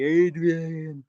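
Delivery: mumbled numb-mouth Rocky call; Sylvester Stallone. Retold or imitated. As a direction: slur it like Rocky calling Adrian. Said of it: "I was speaking like Sylvester Stallone".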